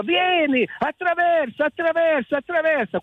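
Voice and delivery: high-pitched beckoning call. Retold or imitated. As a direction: call them across, high and urging. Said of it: "Ci dico".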